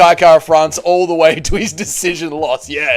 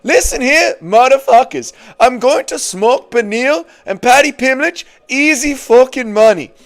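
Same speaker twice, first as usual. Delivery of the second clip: a much higher put-on trash-talk voice; hammed-up tough guy; 10 semitones higher, 4 dB louder than usual. Streamer reading a fighter's boast from chat in a hammed-up voice. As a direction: ham up a cocky fighter's boast.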